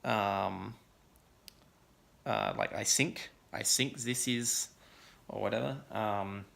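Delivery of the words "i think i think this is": "I think" said as "I sink", th turned to s. Retold or imitated. Imitating choir singers who can't say th, "sink". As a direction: replace the th of "think" with a plain s. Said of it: "so they say it as, like, an S instead".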